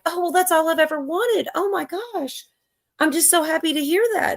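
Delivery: high-pitched, gushing relief; delighted woman. Acted. Bird owner thrilled by the bird's apology, delighted. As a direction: play her overjoyed, voice lifted high. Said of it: "the woman's like".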